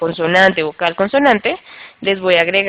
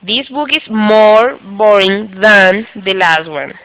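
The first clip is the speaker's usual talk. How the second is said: slow example sentence; much slower than usual. Shown as a demonstration. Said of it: "Por ejemplo".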